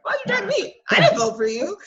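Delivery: a hint of a shrill, startled protest, rising high on why'd; imitating Katie's laughing disbelief; mock surprised Katie. Katie balks at being dragged down, mock surprised. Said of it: "Katie's like".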